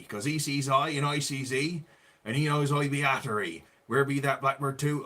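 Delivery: lilting West Country dialect rhyme; West Country accent. Pretend. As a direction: recite it like a West Country yokel. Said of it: "Going proper West Country".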